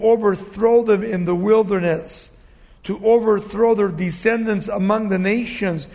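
forceful preaching. Acted. Preacher reads God's judgment to the congregation, forceful preaching. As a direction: proclaim the judgment with full projection.

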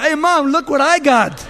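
put-on high, loud, laughing kid's brag; kid showing off. [name] as a boy showing Mom his trophy, giggly and loud. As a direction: brag to Mom like an excited child, laughing through it.

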